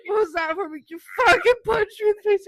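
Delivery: high, loud mock outrage; imitating an accusing shout, half laughing; retold indignant yell. Boyfriend punched in his sleep, mock outraged yell.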